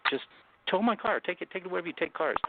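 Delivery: high, insistent plea to the officer; begging them to just take the car; pleading. Retold or imitated. Telling the officers to tow his car, high and pleading.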